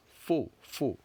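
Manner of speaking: faux with a pure closed o, repeated; teacher demonstrating faux; French sound. Shown as a demonstration. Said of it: "is the faux sound".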